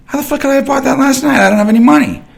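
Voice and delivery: high, loud disbelieving shout; incredulous yell. Retold or imitated. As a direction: yell it like you can't believe it. Retold disbelieving outburst.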